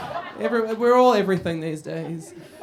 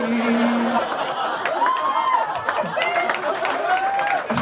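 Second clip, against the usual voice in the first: hammed-up evil cackle; 5 dB louder than usual. Evil laugh after mock prophecy.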